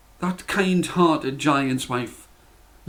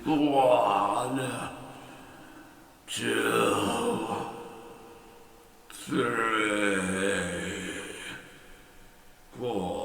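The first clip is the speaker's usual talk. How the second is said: each number lower and softer, fading to a whisper; giant falling asleep; 5 semitones lower, 9 dB quieter, much slower than usual. A narrator's reading. Giant counting again until he falls asleep. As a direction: drift off mid-count.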